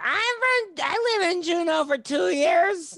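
high put-on voice mocking an audience member. Comic mocks an audience member's answer in a high put-on voice. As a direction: squeak it out like a clueless fan.

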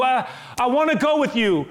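the freed man begging Jesus loudly to take him; loud desperate plea. Acted. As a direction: call it out desperately, pleading hard. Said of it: "he's begging".

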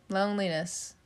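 usual speaking voice

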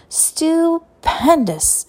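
very slow, high drawn-out praise; gushing. A narrator's reading. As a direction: savor the word. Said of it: "she said again".

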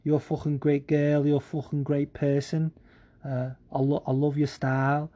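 lightly mimicked Scouse speaker; imitated Scouse accent. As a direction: quote her in a fake Scouse accent. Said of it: "She was like".

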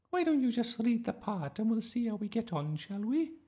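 high, loud sing-out teacher voice; fussy drama teacher. A narrator's reading. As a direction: call the class to order, high-pitched. Voices teacher Miss Darling.

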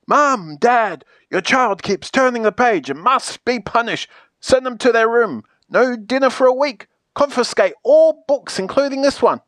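high, indignant tattling; mounting outrage, climbing in pitch; calling out to parents. Acted. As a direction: call Mum and Dad and demand punishment. Calling the parents to tell tales.

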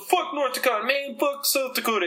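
high-pitched imitation of rival neighbors talking trash; mock trash talk. North Dakota and South Dakota trading insults, mock trash talk. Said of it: "probably talking shit to each other".

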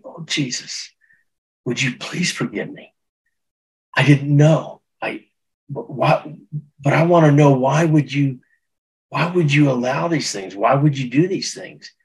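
high, pleading questions to Jesus; anguished high plea, as if on his knees; pleading cry. Retold or imitated. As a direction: beg Jesus for answers through tears. Crying out to Jesus, crying.

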